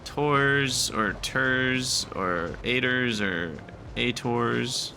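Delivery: "tors" with OR, "ters" with ER, "ators" as AY-ders then AY-tors; variant word endings. Shown as a demonstration. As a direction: slowly sound out "tors", "ters", "ators" as separate options. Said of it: "so many ways you could pronounce that word".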